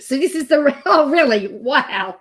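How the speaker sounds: amused disbelief. Real, not acted. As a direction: laugh mid-sentence in amazement. Reacting in disbelief at real transcript.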